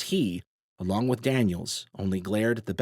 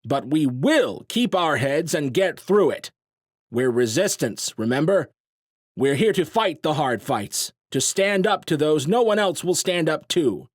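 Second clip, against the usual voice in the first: rallying shout; 7 semitones higher, 5 dB louder than usual. A narrator's reading. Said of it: "nearly shouting now".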